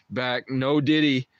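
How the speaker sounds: usual speaking voice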